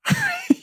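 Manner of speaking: a sudden high amused laugh; high burst of laughter. Real, not acted. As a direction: laugh out at the irony. Laughs at own paradox.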